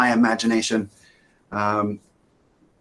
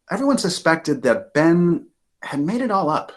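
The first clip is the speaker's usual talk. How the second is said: plain reading voice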